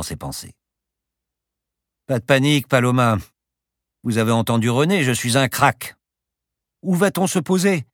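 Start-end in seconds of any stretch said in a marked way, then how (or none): 2.1-6.0 loud, higher-pitched, self-assured pilot voice; boastful pilot calling to his passenger; cocky pilot. Acted. 6.8-7.9 high, loud, insistent question; insistent passenger raising her voice. A narrator's reading. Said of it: "insista Paloma".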